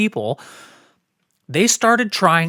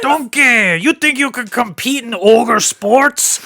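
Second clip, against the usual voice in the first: loud, higher laughing ogre voice with Scottish burr; mock the other host as a chuckling ogre; Shrek voice; 5 semitones higher, 5 dB louder than usual. Teasing his cohost, a laughing Shrek voice.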